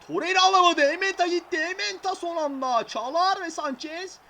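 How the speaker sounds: high, loud urgent commentary; tense call. Real, not acted. Calling the opponents' attack, tense commentary. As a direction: call the danger quickly. Danger in defence.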